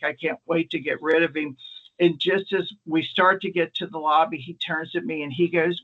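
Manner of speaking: ordinary delivery, nothing marked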